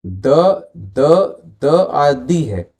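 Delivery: the को पहले द फिर दी बोलो; the: कमज़ोर द, फिर लंबा दी; द और दी का उच्चारण. Shown as a demonstration. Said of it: "Look at this". (the के दोनों रूप दिखाना)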